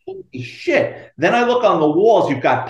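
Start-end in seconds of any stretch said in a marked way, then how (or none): 0.1-1.1 slow, high retold amazement; stunned. Retells finding a hundred chickens.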